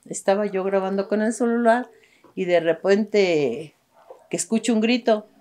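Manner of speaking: ordinary talk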